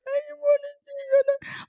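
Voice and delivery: squeaky-high mock crying with a pout; pouting toddler fake cry. Mom acting out her small creature's plea, pouty fake cry. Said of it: "like, the lower lip pout".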